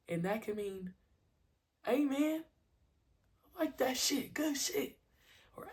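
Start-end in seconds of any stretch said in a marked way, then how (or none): 1.8-5.0 high, hyped-up praise; approving yell. Shown as a demonstration. Coach praising a kid's good play, excited yell. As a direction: shout your approval to the kid. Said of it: "or if it's, like, good".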